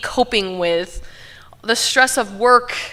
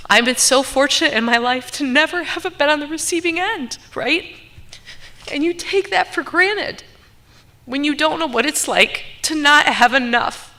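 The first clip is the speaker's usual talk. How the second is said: higher, voice tightening with real emotion; fighting back tears as she speaks; getting emotional; 7 semitones higher than usual. Real, not acted. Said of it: "sorry, I didn't expect to get emotional".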